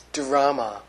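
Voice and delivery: usual speaking voice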